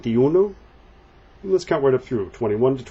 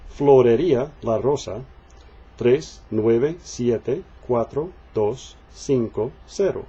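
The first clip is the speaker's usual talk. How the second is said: slow drill reading. Shown as a demonstration. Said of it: "Let's read them through".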